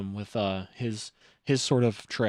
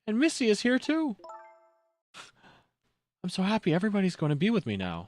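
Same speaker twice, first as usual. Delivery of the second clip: put-on higher, happy childlike voice; voicing a little girl; 7 semitones higher than usual. Camila glad everyone is with her, high and happy. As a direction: beam with relief, sing-song.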